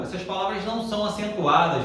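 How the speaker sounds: ordinary delivery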